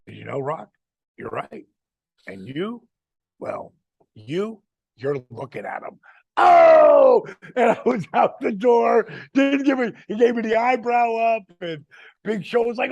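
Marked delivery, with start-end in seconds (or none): 0.1-6.0 flat, dry deadpan comeback, softer; understated deadpan burn. Retold or imitated. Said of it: "And I just deadpanned him". 6.4-11.1 loud, high whooping shout; huge high-pitched hype yell; excited shout. Retold or imitated. Crowd reaction, storming out.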